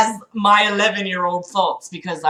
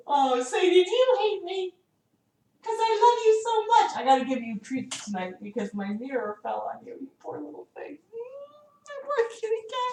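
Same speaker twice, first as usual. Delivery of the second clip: cooing to pet; 9 semitones higher, 10 dB quieter than usual. Real, not acted. Owner apologizing to cat Sadie, real tender cooing. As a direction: coo tenderly over a poor little pet.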